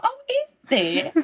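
very high, drawn-out coy question; mock coy surprise. Replying to commenters' praise, mock coy surprise. Acting own reaction to praise.

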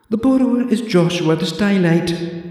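high prim librarian voice for Joshua the Stylite; haughty old librarian announcing the name; librarian Deirdre. Acted. Deirdre tells the visitor the borrower's name in a high prim voice.

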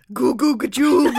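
loud, high wild bellow. Acted. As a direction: bellow it like a crazed walrus. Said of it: "The walrus bellowed wildly".

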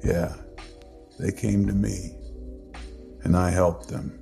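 subdued, sullen uncle with long pauses. A narrator's reading. Uncle insists people came to him, sullen and subdued. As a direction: repeat it sullenly, softly, piece by piece. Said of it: "he repeated sullenly".

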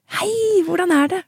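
cheery Norwegian hello. Retold or imitated. Father answering his daughter's call, high cheerful Norwegian greeting. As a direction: greet like a beaming Norwegian grandpa. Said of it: "han är norrman".

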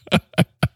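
laughing at own joke; real laugh. Real, not acted. Host cracking himself up, real laugh. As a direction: let out an amused laugh. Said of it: "when I make myself laugh".

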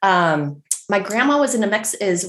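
ordinary talk

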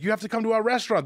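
high, quick imitated German accent. German restaurant owners inviting a new friend over, imitated German accent. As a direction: retell it as an insistent German host. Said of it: "Do your German accent".

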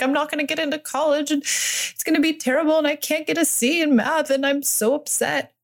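imitating a stressed teen daughter; upset teen daughter. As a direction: spill it out like a worried teen. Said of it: "She's like".

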